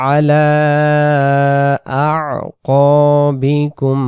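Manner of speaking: slow melodic chant, words drawn out; Quran recitation. Shown as a demonstration. Teacher chanting the verse for students to copy. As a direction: recite it slowly and tunefully.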